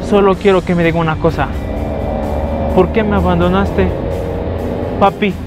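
ordinary delivery

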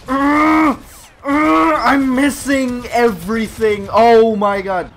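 loud, high, slow groaning; frustrated groan. Real, not acted. Frustrated in game.

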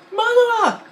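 very high, loud mock outrage; Mister Potato Head impression. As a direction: scream it at Woody. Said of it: "and he was like".

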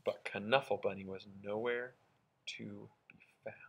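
soft then whispered; fading to a whisper. A narrator's reading. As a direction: let it fade into a whisper. Storybook suspense, fading to whisper.

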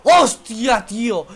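loud high exclamation; excited shout. Real, not acted. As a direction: blurt it out in awe. Reacting to a save.

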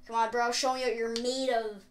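ordinary talk, nothing marked

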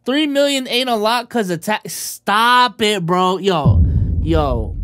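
loud heated protest. Real, not acted. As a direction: shout them down. Heated objection about taxes.

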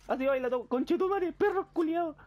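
high, loud yelled insults; furious shout. Real, not acted. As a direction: scream the insults at the monster. Yelling insults at enemies.

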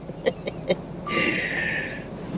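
high airy giggle, drawn out; long breathy laugh. Real, not acted. Pilot laughing as he checks his drone after landing.

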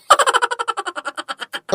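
sudden high falling laugh; a squeaky burst of laughter; high-pitched giggle that falls away. Acted. Laughing at Shantaram's delay.

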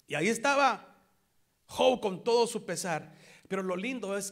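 loud, high preaching; full-voiced sermon; preaching shout. Real, not acted. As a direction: proclaim it to the church.

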